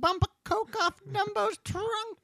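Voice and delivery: high silly cartoon voice; squeaky toon suggestion. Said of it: "pretty good impressions".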